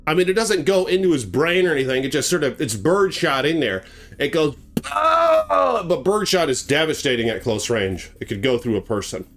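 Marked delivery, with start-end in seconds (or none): 4.9-5.8 sharp high cry of pain. Retold or imitated. Matthew crying out as the birdshot hits his face, sharp pained cry. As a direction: cry out as if hit.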